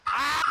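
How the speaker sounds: sharp loud frustrated "ah"; frustrated yell. Real, not acted. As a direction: blurt it out in frustration.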